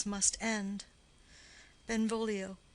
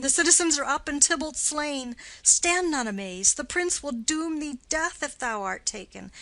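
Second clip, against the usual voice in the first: normal talking voice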